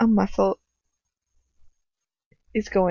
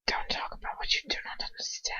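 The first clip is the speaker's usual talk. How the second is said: cold, soft threatening whisper; menacing whisper; 11 dB quieter than usual. A narrator's reading. Captor silences the girl with a menacing whisper. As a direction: warn her in a deadly hush. Villain warns her coldly.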